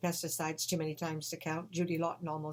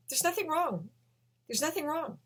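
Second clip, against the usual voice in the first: much higher, insistent denial; high protesting voice; 10 semitones higher than usual. Retold or imitated. She insists, voiced by the teller.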